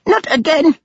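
high-pitched wail of exasperated dismay; dismayed cry. A narrator's reading. Servant seeing the aunt's state, crying out in dismay. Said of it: "Subradil cried".

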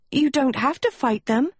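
high-pitched urgent plea; pleading, high character voice; pressing. Acted. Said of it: "Agatha pressed".

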